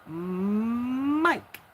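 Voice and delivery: long hummed onset, rising high and loud; playful drawn-out tease. Real, not acted. Teasing Mike in the chat over his mm, drawn-out rising swell. Playfully echoing Mike's big mm.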